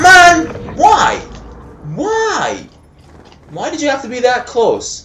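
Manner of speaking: high, loud, protesting cry; anguished wail. Real, not acted. Gamer laments an enemy standing too close, anguished wail. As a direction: wail the complaint at a high pitch. Wails at being killed up close.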